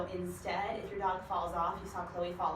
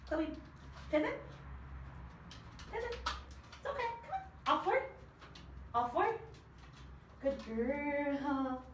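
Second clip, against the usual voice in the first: high sing-song dog praise; 7 semitones higher, much slower than usual. Real, not acted. Trainer coaxing her dog Chloe onto the stool, high excited praise. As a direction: cheer the dog on in a bright pet voice.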